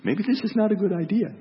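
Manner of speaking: high-pitched small voice of doubt; little inner voice. Acted. Preacher voices his unheeded inner warning, in a high little voice. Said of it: "a little voice in me that was saying".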